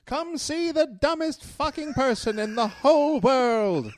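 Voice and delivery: high, slow barker call through a laugh; carnival barker. Acted. Spouse barking an insult mid-fight like a barker. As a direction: hawk it like a sideshow, laughing.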